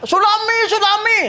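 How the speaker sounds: high-pitched mock warning call; imitated alarm cry. Man by the beach raising a tsunami alarm, imitated high cry. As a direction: call it out high, without real volume. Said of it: "ada orang itu teriak".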